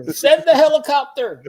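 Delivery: high yell through laughter; laughing mock yell. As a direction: shout it while cracking up. Joking rescue call, laughing.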